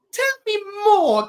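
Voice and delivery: high mock voice. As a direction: squeak it like an amnesiac fool. Malcolm voiced in recap.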